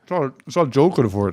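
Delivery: talking with a mouthful of pear; words round a bite of food; mouth full. Real, not acted. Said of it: "Can you not speak with your mouth full".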